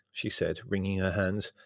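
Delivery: ordinary delivery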